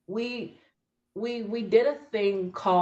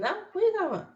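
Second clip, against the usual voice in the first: surprised disbelief; 12 semitones higher, much faster than usual. Retold or imitated. Retelling confusion at unknown term.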